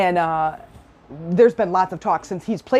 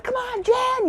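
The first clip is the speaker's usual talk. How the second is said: imitated fan cheer; slower than usual. Somebody cheers her running down for the layup, imitated high cheer. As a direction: squeal out encouragement like a distant fan.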